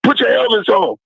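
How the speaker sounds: high-pitched mock coach command; retold order, voice jumping high; coach's order. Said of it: "He's like".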